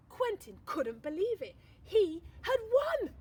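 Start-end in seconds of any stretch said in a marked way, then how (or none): 1.8-3.1 much higher, louder, slow joyful cry; higher, louder thrilled exclamation; triumphant. A narrator's reading. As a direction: announce the victory with delight. Triumphant climax of story.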